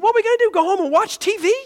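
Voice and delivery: fast, loud, high-pitched exasperation; imitating irritation. Irritated man objecting to ending the meeting, exasperated. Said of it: "He was kinda irritated".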